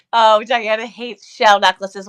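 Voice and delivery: normal talking voice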